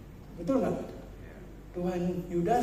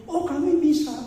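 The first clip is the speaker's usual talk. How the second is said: imitating overconfident disciples, louder and higher; cocky confidence; 6 semitones higher, 6 dB louder than usual. Disciples answering Jesus that they can, cocky confidence. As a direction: blurt it with mock self-assurance. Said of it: "Lalu dengan pedenya".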